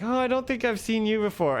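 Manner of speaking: high, playful mock denial; joking denial. Pakistani guy joking back to the interpreter, mock denial. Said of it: "just joking around".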